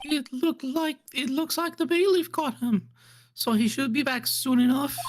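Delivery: high-pitched character voice; game character. Acted. Reporting to the court where he went, high character voice.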